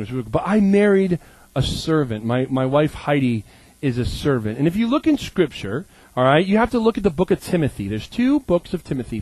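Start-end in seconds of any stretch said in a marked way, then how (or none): none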